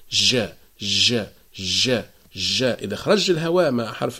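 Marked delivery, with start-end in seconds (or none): 0.1-2.6 ج with breath escaping, drifting toward ش; airy wrong jim. Shown as a demonstration. Teacher showing students the breathy jim mistake. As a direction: let air leak on each ج. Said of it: "يقولوا".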